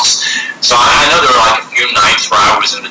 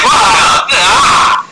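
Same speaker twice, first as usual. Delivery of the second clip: shocked yell; 8 semitones higher than usual. Retold or imitated. Reacting to the girl's head falling off, shocked mock yell. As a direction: jump at the sudden horror. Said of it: "It's just like".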